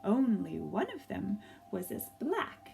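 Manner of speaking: gentle storybook narration with lively swells; reading to children. A narrator's reading. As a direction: tell it like a picture book read to a class.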